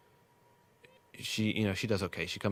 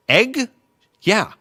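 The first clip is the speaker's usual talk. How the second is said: much higher, louder squeaky "Egg?" and "Yeah"; mimicked cashier; 13 semitones higher, 11 dB louder than usual. Cashier mocked with a high, loud squeak. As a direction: parody the mumbling worker. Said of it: "she wasn't enunciating".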